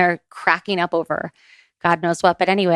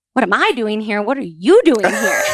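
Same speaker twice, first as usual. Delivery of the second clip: higher and louder playful echo, rising at the end; dad playfully turning the question back on his daughter; teasing echo; 4 semitones higher, 4 dB louder than usual. Retold or imitated. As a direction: throw her question right back. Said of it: "And he goes".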